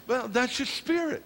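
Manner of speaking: normal talking voice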